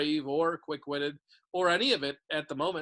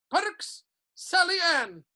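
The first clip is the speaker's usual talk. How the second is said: calling out names; 12 semitones higher, 7 dB louder, much slower than usual. A narrator's reading.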